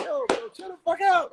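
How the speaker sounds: very high, squeezed retort; panicked pleading. Retold or imitated. Young comic hushing his heckler, high pleading squeak. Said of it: "I'm like".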